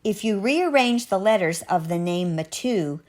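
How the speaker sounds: ordinary delivery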